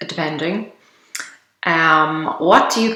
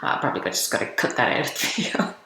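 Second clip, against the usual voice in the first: muttered aside; 6 semitones lower, 9 dB quieter, much faster than usual. Real, not acted. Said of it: "aside to herself, muttered".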